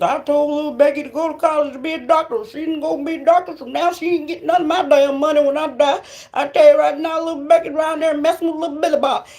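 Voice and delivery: mock the parent cutting off the daughter; disapproving parent. Comedian mocking a parent ranting about disowning little Becky.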